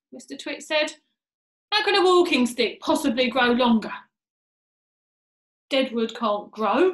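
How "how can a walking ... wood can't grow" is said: loud, emphatic dismissal; scornful scoffing. Acted. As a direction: hammer it home as if to a fool. Mr Twit scoffing at his wife.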